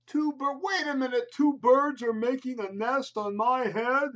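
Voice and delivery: a baffled, put-upon elephant; Gerald the elephant. Acted. Elephant asking his friend Piggy, high and baffled. Gerald voiced in picture book.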